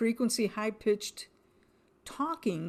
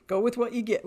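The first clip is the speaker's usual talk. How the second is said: laughing; 4 semitones higher, 4 dB louder, faster than usual. Retold or imitated.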